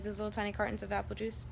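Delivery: ordinary talk, nothing marked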